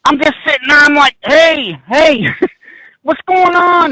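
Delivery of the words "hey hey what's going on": higher and louder, slowed panic; frightened calling out. Retold or imitated. Trapped on stairs, calling out in panic. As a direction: call out for help, scared.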